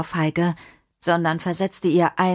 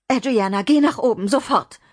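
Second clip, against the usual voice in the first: higher, alarmed command; urgent order; 5 semitones higher than usual. Acted. Mimi sending Adriana upstairs from danger, urgent. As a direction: send the child off urgently.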